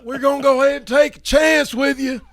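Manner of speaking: high, loud Texas coach. Retold or imitated. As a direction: bark it like a high school coach. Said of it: "That coach, that coach voice".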